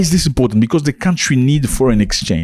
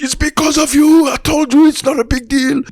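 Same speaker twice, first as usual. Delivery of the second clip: mock angry scream. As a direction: imitate him blaming you in a shrill mock yell. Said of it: "This Nigerian guy screaming at me".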